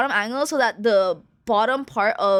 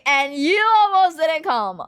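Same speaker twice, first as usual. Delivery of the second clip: higher, louder smug told-you-so; gloating louder at mom; teasing gloat; 6 semitones higher, 4 dB louder than usual. Retold or imitated. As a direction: rub it in harder. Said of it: "I have to take the opportunity to be annoying".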